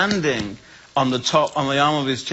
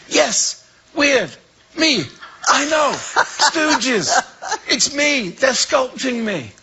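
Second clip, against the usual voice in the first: excited babble; 11 semitones higher, slower than usual. Retold or imitated. Waking up and explaining the dream to his wife, high and excited. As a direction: blurt it out, thrilled.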